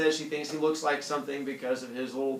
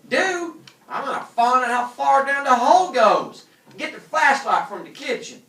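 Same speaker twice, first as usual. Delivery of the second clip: high, loud excited commands; excited orders; 12 semitones higher, 12 dB louder than usual. Acted. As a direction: bark orders, fired up. Calvin bossing Dora.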